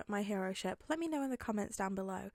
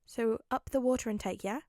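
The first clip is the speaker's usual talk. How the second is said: plain reading voice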